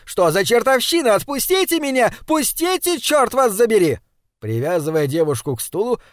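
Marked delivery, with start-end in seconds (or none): 0.1-4.0 much higher, frantic cursing; furious yelling. Acted. Struggling maid curses her captors, furious yelling. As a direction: scream at them to let go.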